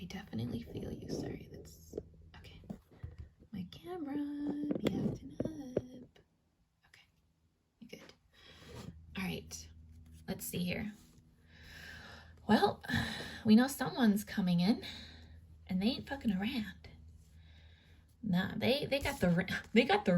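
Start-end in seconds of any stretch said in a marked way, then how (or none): 0.1-5.8 soft unvoiced whisper; hushed aside. Real, not acted. Whispering to camera. 9.2-10.9 whisper. Real, not acted. Whispering to camera. 15.7-16.7 whisper. Real, not acted. Whispering reading.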